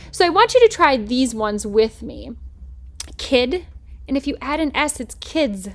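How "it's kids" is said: put-on kids ending in z. Shown as a demonstration. Teacher modelling plural kids. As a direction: let the z buzz in kids. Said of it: "S sound or the Z sound".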